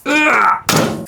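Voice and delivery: high groan of disgust; disgusted ugh. Real, not acted. Cook groans at the burnt-looking gammon colour, disgusted. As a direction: recoil at the sight with a high ugh.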